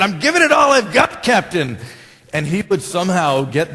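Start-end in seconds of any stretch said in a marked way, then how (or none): 0.0-1.8 high, loud mock yell; hammed-up shout, pitched way up; Scotty. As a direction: yell back to the captain at full strain. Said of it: "what did Scotty always yell back".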